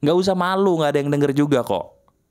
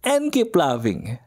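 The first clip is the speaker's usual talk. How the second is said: cheerful presenter farewell over music; upbeat show sign-off; 6 semitones higher than usual. Acted. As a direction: sign off brightly like a radio host.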